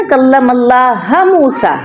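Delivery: slow recitation with अल्लाह ending in 'ha'; misread vowel. Shown as a demonstration. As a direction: stretch अल्लाह into 'Allaha' instead of 'Allahu'. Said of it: "हु की बजाय हा पढ़ दे".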